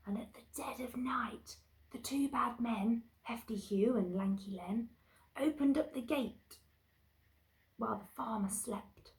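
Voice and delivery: whispery suspenseful story reading; tiptoeing bedtime-story suspense; hushed narration. A narrator's reading. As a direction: creep through the words in a hush. Hushed narration of night creeping.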